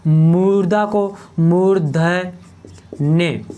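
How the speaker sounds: slow teaching delivery, breaking मूर्धन्य into parts; slow, syllable by syllable. Shown as a demonstration. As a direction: draw out मूर्धन्य in separate syllables. Teacher spells out new term slowly.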